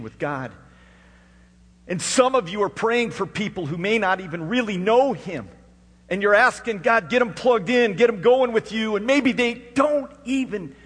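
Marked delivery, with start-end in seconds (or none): 1.9-10.7 high, loud preacher's cry; loud, high fervent preaching; full-force preaching. Acted. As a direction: preach it out to the whole room.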